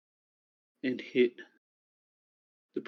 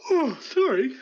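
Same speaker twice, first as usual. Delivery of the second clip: apologising mid-yawn; words stretched through a yawn; 12 semitones higher, 6 dB louder than usual. Real, not acted.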